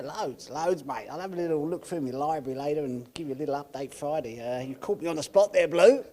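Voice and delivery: put-on a lightly done Australian accent, caught on the spot; Aussie accent. [name] recommends Netflix to the interviewer in an Aussie accent. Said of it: "in a full Aussie accent".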